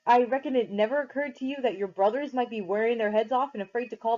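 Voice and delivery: angry scolding. Acted.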